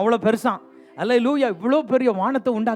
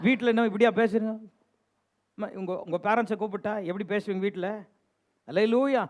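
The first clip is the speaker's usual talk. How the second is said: ordinary talk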